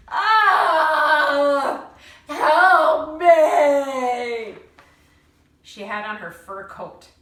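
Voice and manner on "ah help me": loud, high desperate shout; shouted cry for help. A narrator's reading. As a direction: scream it out for help. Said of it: "Granny shouted".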